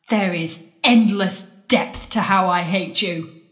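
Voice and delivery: normal talking voice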